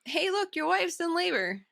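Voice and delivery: high mock-alarmed call to grab attention; urgent distraction. Host voices someone distracting Anakin with fake news, mock urgent. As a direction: fake an emergency to distract him.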